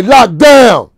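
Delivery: high, very loud sheriff's call; shouted command. Retold or imitated. Sheriffs halting the inmates, imitated full shout. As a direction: holler it down the hallway as an order.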